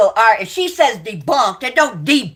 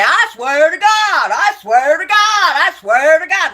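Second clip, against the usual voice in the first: mocking imitation; 7 semitones higher, 4 dB louder than usual. Mocking a rival streamer's habit of swearing to God, in a high nasty parody. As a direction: mimic her whining oath with contempt. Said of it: "'cause atheists do not say".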